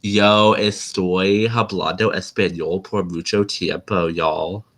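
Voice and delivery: slow Spanish in a thick American accent; bad American accent. Shown as a demonstration. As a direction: butcher the Spanish with deliberate American vowels. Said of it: "a really bad American accent on purpose".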